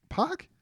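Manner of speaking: "Pac" pitched sharply up as a question; second-guess yourself on "Pac", voice leaping high. Real, not acted. Doubts his own recap, asks himself.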